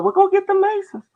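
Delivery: very high, loud falsetto; mocking the girl. The girl sending for the Masons, high loud falsetto. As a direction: squeak it like a bossy girl. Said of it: "She's like".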